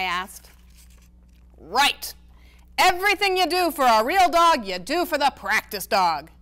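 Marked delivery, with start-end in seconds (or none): 1.6-6.3 exasperated grandpa. A narrator's reading. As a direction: throw up your hands at her. Grandpa Ace voiced in reading.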